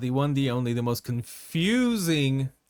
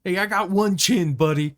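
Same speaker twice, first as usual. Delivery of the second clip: put-on voice; 5 semitones higher, 5 dB louder, slower than usual. Acted impression line.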